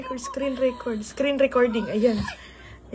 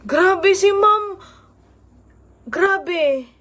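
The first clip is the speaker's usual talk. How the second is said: usual speaking voice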